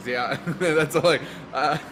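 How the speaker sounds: ordinary talk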